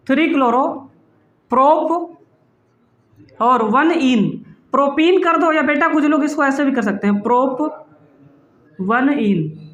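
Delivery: ordinary delivery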